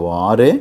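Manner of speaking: వారే loud and held long; forceful, drawn-out వారే; emphatic stress. Shown as a demonstration. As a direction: stretch వారే, lifting the end. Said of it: "emphasize చేసి చెప్తున్నాం".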